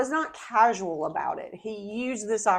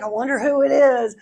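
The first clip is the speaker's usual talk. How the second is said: loud, higher mock wondering, amused; mock cluelessness; 5 semitones higher, 7 dB louder than usual. Joking about the clueless disciples, loud mock wondering. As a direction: play oblivious with a laugh.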